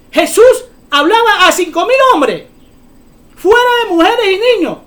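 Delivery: prédica a gritos, más aguda y fuerte; proclámalo a toda voz ante la multitud; gritando para edificar. Real, not acted. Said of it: "yo estoy gritando para edificación". (gritando con fervor de predicador)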